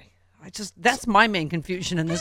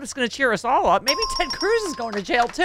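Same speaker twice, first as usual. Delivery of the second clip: ordinary talk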